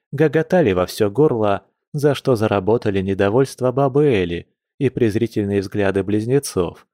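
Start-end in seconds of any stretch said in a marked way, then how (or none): none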